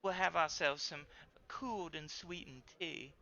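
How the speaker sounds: lightly put-on effete Southern accent; Southern gentleman. Judson Conrad offering Eliza his arm, mild Southern accent. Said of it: "Judson Conrad, the Southern gentleman, says".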